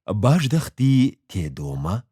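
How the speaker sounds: plain reading voice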